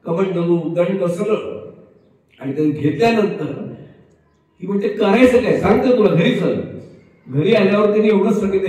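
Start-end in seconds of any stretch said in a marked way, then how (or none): 4.9-6.6 louder, firm advice; firm instructions. Retold or imitated. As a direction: hand it over with firm instructions. Friend gives the wife instructions.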